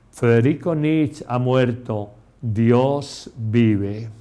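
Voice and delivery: ordinary talk, nothing marked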